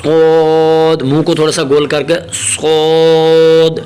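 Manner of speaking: صاد thick with rounded mouth; heavy rounded صاد; heavy saad. Shown as a demonstration. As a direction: make صاد full and heavy. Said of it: "صاد کو موٹا کر کے".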